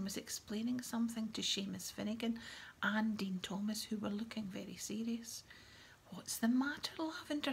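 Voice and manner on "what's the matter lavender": high, concerned question; anxious. A narrator's reading. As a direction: ask with worry, pitched up high. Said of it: "said Hermione anxiously".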